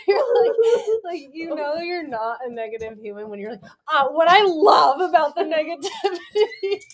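breathless after laughing; after laughing hard. Real, not acted. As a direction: still giggling, voice cracking. Laughing fit in bloopers.

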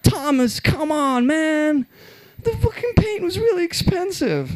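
high, whiny mock complaint; mock exasperated friend. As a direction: whine it at your careless friend. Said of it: "You're like".

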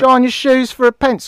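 high, loud Cockney street pitch; London shoeshine boy accent. Acted. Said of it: "the shoe shine boys".

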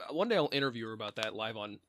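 usual speaking voice

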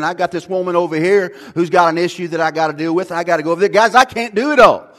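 loud, high, driving sermon voice; preaching at full force. Acted. Pastor to congregation, loud, passionate preaching. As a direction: hammer it home to the congregation.

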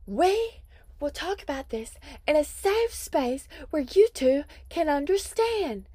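high, loud prissy mom; mock prim mom voice. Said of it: "the mom was like".

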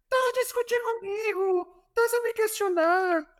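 high-pitched mock coach shout with a Portuguese accent; Jorge Jesus impression. Coach yelling at his player in training, high mock Portuguese voice. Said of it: "ele grita assim".

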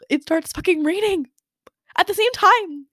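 rising to a high, loud disbelief; exasperated outburst. Real, not acted. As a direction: build into incredulous exasperation. Tears then sudden rain.

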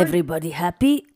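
a hint of a sinister clown; mock clown voice. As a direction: try out the clown's line in a put-on voice. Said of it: "I don't remember his inflection".

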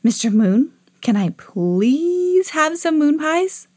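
high, slow request with a long please; patient pleading. A narrator's reading. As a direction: beg sweetly, stretching out please. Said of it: "took a deep breath and started again".

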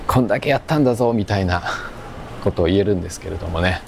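ordinary delivery

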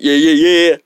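ordinary talk

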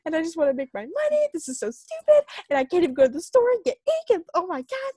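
quickened, shrill-rising panic over shipping deadline; freaking out. Retold or imitated. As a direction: spiral into a frantic retold panic. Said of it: "I started freaking out".

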